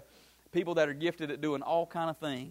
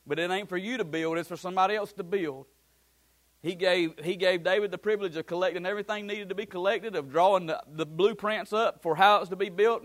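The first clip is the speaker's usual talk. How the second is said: louder and higher, driving preacher's cadence; preaching at full force; 4 semitones higher, 6 dB louder than usual. Acted. Pastor telling the congregation about David, preaching hard. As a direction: build it up and lay it on the church.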